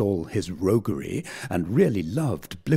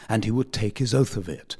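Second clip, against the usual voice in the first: plain reading voice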